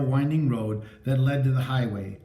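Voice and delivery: ordinary delivery, nothing marked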